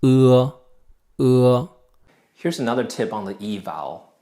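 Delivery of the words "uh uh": "uh" luider, kort en dof; "uh" duidelijk voorgezegd; Pinyin e. Shown as a demonstration. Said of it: "it's actually pronounced".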